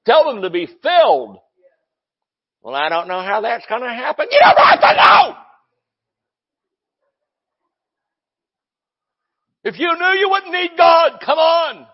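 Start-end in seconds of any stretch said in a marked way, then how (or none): 2.6-4.3 mock whining doubter with a crying edge; hammed-up doubting complaint; whiny doubter. Mocking a doubting churchgoer. 4.3-11.9 much higher, loud shouted rebuttal; full-force sermon shout; furious preaching shout. Real, not acted. Preacher answers doubter forcefully.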